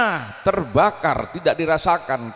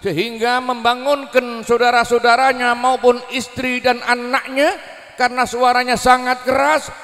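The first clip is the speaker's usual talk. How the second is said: normal talking voice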